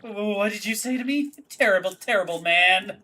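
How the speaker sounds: a spoiled daughter storming off; Francesca indignant. Acted. Daughter refusing the hero, high and indignant.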